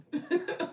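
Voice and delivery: breathy laugh. Real, not acted. Laughs about TikTok tripping.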